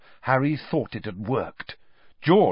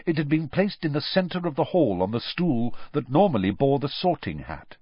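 ordinary delivery, nothing marked

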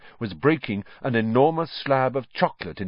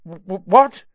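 stammered; 7 semitones higher, 11 dB louder than usual. Acted. Harry waking dazed and stammering.

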